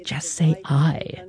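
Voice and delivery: softened, slow quote of a whispered prompt; soft, unhurried mock whisper; imitated whisper. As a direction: lightly imitate a discreet whispered prompt, slowly. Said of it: "Patty Murray there whispering to Feinstein".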